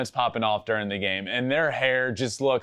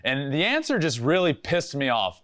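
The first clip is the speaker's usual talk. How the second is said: normal talking voice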